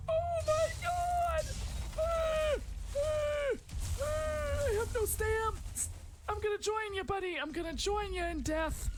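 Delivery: high, slow panicked cries; facing death in the game, high panicked cries. Real, not acted. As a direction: shriek with mounting dread. About to die in game.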